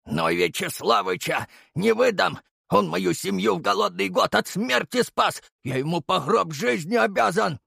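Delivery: put-on a frightened old man clinging on; desperate old watchman. A narrator's reading. Terrified watchman refuses to betray. (отчаянный голос старика-сторожа, выше)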